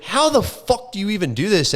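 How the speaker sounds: high, bewildered exasperation; exasperated. Retold or imitated. To himself after the flop, high exasperation. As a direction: throw up your hands at yourself. Retold frustration after failing.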